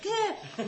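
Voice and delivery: high squawk of distress; mock wail. Daughter mimicking her own sensitive meltdown. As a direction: blurt a hammed-up gah. Imitating messed-up crying self.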